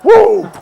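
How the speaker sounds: loud high whoop. Acted. Preacher cheering at the congregation with a loud high whoop. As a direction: throw out a big joyful whoop. Skit crowd cheer, exuberant.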